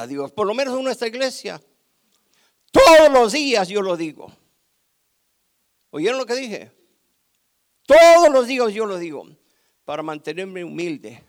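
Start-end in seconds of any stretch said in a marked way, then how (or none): 2.7-4.3 loud, punched declaration; loud emphatic declaration. Real, not acted. Preacher insisting to his church, loud. Preacher stresses daily confession. 7.9-9.3 loud, punched declaration; loud emphatic declaration. Real, not acted. Preacher insisting to his church, loud.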